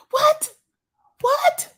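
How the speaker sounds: very high squeak of disbelief; shocked what. Acted. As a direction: yelp it in comic shock. Comic disbelief.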